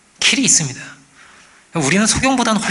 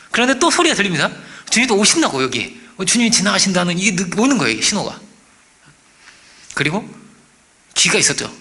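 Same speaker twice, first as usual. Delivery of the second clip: usual speaking voice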